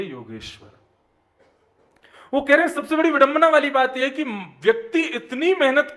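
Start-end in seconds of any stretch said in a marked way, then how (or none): 2.3-6.0 emphatic preaching. Acted. Preacher stresses key point.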